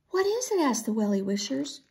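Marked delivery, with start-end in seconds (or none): 0.1-0.6 high, urgent question; anxious curiosity. A narrator's reading. Friends react to Willa's gasp, anxious. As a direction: ask it high, eager to know.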